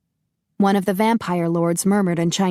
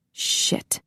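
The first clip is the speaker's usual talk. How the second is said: softly breathed swear; whispered curse; 5 dB quieter than usual. A narrator's reading. Grigori's inner curse.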